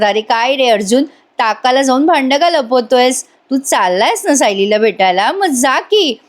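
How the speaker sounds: ordinary delivery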